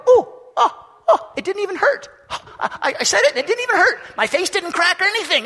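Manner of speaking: high, loud, giddy surprise; shy believer after first hallelujah; amazed Adventist. Retold or imitated. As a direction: sound amazed it didn't hurt. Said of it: "they're gonna go".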